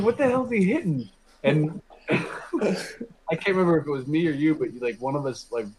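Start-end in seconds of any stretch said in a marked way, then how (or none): 0.0-1.1 high puzzled cry; mock bewilderment. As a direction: sound baffled watching the dog. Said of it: "I was like".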